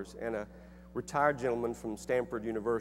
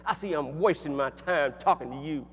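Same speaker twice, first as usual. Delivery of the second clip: higher, louder furious retort; angry old man shout; 5 semitones higher, 7 dB louder, slower than usual. Retold or imitated. Angry professor screaming at the young man, louder and higher. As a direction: spit the words out through gritted teeth. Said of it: "he was screaming through teeth".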